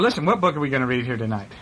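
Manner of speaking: ordinary delivery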